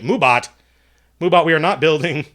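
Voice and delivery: loud, playful telling-off; mock scolding. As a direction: scold the bot in mock exasperation. Mock scolding of the chat bot.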